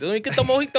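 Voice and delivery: put-on Spanish accent. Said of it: "probably said a Spanish accent".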